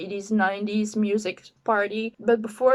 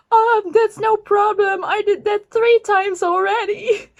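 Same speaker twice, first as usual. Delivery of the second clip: higher, louder casual reassurance; reassuring stranger; 9 semitones higher, 6 dB louder than usual. Retold or imitated. Stranger comforting her at the party, higher and louder. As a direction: brush it off like it's nothing.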